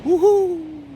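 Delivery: long, high, loud woohoo; excited whoop. Real, not acted. Cold-water swimmer out in the sea, excited whoop. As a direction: let out a big ringing whoop.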